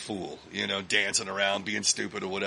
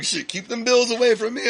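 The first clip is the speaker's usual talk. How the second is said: higher, louder jokey panic; mock alarm; 14 semitones higher, 8 dB louder than usual. Scarface shooing away the bills, mock alarm. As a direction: wave the bills off in fake horror.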